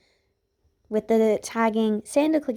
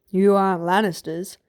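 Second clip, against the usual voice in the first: snapped back; 3 dB louder than usual. A narrator's reading. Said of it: "Sandor snapped back".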